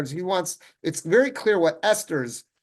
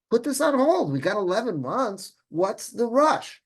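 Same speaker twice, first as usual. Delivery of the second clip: raised, high-pitched imitation of an objection; exasperated protest; 7 semitones higher, 4 dB louder than usual. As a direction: protest loudly, drawing out the final question slowly. Acting out Esther's objection to urgency.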